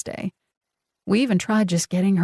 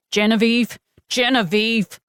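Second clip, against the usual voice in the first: scream; 4 semitones higher than usual. A narrator's reading. Pounding on her rival's door, screaming her name. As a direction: yell it at the closed door. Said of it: "I scream".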